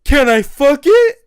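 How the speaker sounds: loud, high-pitched excited question; hammed-up thrilled asking, rising high; mock eager shout. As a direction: imitate a shameless friend blurting it out. Retold friend's outrageous eager question.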